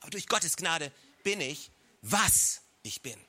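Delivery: pause, then a long, weighty "was"; "was" slowed right down, the words after it dropping away; stress on WAS. Shown as a demonstration. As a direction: hit "was" hard and stretch it out. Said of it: "Betonung auf was".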